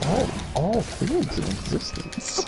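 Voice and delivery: slow, breathy godly murmur; soft Zamasu echo. Retold or imitated. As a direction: hush it out grandly. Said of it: "You talk like that".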